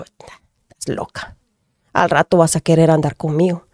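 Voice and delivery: mocking husband. Husband mocks his wife's new faith, scoffing softly. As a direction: scoff at her under your breath.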